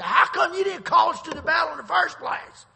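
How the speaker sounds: high, loud indignant shout; furious demand to be let into the fight; angry shout. Retold or imitated. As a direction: yell it like an offended warrior. Angry tribesmen confront Gideon.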